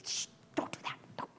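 put-on hushed whisper to a child; mother hushing her son in the crowd. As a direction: whisper sharply, telling him to stop.

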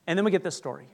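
ordinary delivery

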